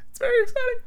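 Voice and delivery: real crack in the voice, leaping up high. Real, not acted. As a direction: let the voice break and squeak upward. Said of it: "why my voice is cracking".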